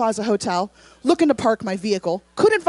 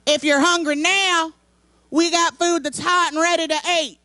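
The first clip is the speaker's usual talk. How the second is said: simple Southern voice; 5 semitones higher than usual. Retold or imitated. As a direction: imitate a homespun Southern ad announcer. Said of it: "it had a simple Southern voice that said".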